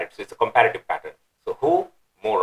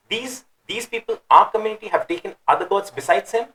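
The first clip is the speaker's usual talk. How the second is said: much higher, incredulous "These, these people"; indignant exclamation; 8 semitones higher than usual. Shown as a demonstration. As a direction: exclaim in scornful disbelief. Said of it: "So that sort of intonation is implied".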